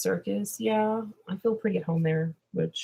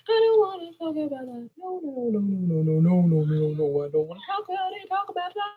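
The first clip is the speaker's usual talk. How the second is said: whiny refusal; 6 semitones higher than usual. Pretend. Distorted whining refusal to talk.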